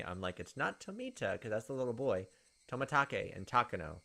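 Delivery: ordinary delivery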